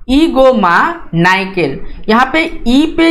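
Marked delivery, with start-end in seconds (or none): 0.1-1.8 ईगो मा नाइकल read slowly, stress on ईगो; slow model reading of ईगो मा नाइकल; read aloud for repeating. Shown as a demonstration. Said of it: "इसको मैं पढ़ता हूँ, आप भी पढ़िए".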